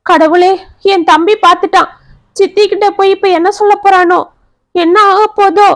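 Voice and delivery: high, anxious lament; frightened. Acted. Revathi fears her brother will tell her stepmother, high frightened wail. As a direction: panicky fear of what the stepmother will do. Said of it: "நடுங்கிய Revathi".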